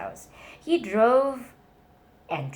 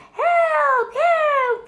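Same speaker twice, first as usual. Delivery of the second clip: much higher, loud panicked cry; crying for help; 11 dB louder than usual. A narrator's reading. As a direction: yelp it out, pants around his ankles. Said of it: "cried the old mouse".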